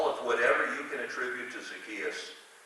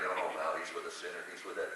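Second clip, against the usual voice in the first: low, quick disapproving mutter, softly tossed off; imitated muttering; 7 semitones lower, 6 dB quieter than usual. Preacher voices the crowd seeing Jesus with a sinner, muttering. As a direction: grumble it low like a scandalized bystander. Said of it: "while everybody's muttering".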